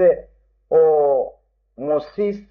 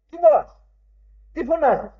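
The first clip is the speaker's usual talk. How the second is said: higher, stern repeated rebuke; God calling out; 7 semitones higher than usual. Acted. God rebukes silent Moses, higher stern call. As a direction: ask it twice as a raised divine reproach. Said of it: "Και του φωνάζει".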